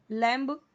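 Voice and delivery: लैम्ब with the final ब fully sounded; drawn-out लैम्ब with an audible ब tacked on; wrong B-sounded लैम्ब. Shown as a demonstration. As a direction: say लैम्ब wrongly, releasing a clear "buh" at the end. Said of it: "ऐसे पूरा ब नहीं बोलना है".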